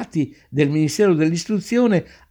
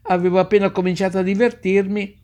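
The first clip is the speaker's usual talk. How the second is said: mild protest; 5 semitones higher than usual. Acted. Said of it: "che protestava".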